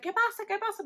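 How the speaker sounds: very high, urgent repeated Spanish question; imitated nosy mom. Mom in the car demanding news, high urgent imitation. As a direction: pester in a high excited mom voice.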